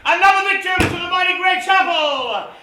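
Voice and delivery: big-moment commentator call; excited commentary. Acted. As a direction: hype the wicket like a commentator. Commentator calling a wicket.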